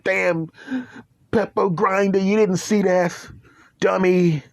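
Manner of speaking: scolding told-you-so. Retold or imitated. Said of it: "I said".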